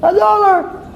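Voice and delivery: very high, squeaky find-a-prize voice; hammed-up high-pitched delight; delighted passer-by. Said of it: "And a person walks and".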